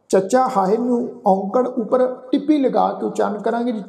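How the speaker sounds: ordinary delivery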